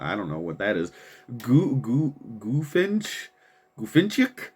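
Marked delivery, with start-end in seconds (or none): 1.4-4.5 halting, higher-pitched questioning stab at "Gufinchik"; unsure sounding-out. Real, not acted. Host puzzling over a listener's handle, unsure sounding-out. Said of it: "I can't pronounce".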